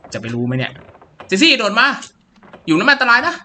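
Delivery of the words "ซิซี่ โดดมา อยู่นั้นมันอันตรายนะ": higher, louder urging to jump; raised urgent plea; urgent call. Acted. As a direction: call her across the gap, fast.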